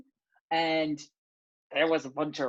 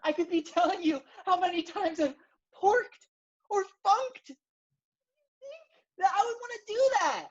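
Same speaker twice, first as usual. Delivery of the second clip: put-on much higher, his British accent slipping away; British accent dropped; 14 semitones higher than usual. Guest ranting at the hosts, British accent slipping away. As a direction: lose the British accent mid-rant. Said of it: "First of all, where's your accent?".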